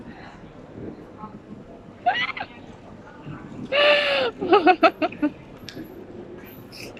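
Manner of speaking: high, breathless burst of laughter; real cracked-up laughter. Real, not acted. Laughing after impression.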